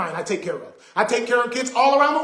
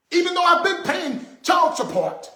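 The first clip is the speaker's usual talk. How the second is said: raised, high-pitched indignant rant; frustrated outburst; 7 semitones higher than usual. Real, not acted. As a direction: push it up and out in protest. Ranting about child support.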